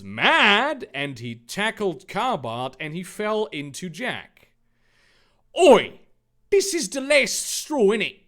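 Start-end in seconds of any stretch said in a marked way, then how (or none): 5.6-8.2 high, loud cockney shout; furious cockney outburst; cockney yell. Acted. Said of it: "Jack yelled".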